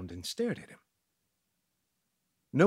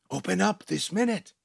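raised, loud demand; insisting; 6 semitones higher, 5 dB louder than usual. Acted. Priest demanding entry through the door, insistent. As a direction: demand the door open right now. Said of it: "the priest insisted".

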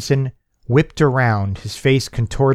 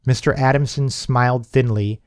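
plain reading voice

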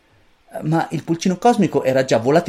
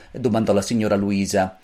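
ordinary delivery

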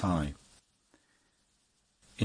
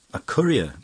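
a loud, high-pitched cry of amused disbelief; laughing surprised cry; 7 semitones higher, 7 dB louder than usual. A narrator's reading. Poet laughs in disbelief at his friend's new trade, crying out loudly. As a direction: toss the word back, half laughing. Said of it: "cried Mascari, laughing".

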